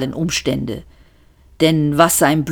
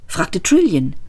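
ordinary delivery

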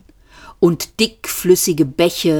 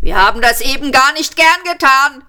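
high, louder shouted apology; shouting policeman; 9 semitones higher, 4 dB louder than usual. A narrator's reading. Policeman shouting his regret to the group, loud. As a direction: yell it across, half apologetic. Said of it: "schrie der andere Polizist".

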